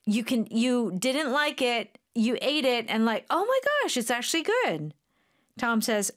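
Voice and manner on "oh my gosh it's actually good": high-pitched amazed discovery; pleasantly surprised. Acted. Eater who didn't like it finds it good, pleasantly surprised. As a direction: act out surprising yourself with a liking. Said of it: "If you surprise yourself".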